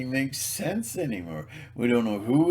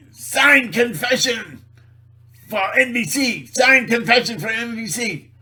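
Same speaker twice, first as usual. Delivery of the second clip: harsh interrogator; 9 semitones higher, 8 dB louder than usual. Acted. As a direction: press the prisoner with menace. Acting an interrogator.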